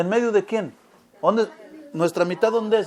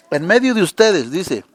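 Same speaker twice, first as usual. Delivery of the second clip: ordinary delivery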